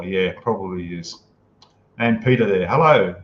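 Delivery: ordinary delivery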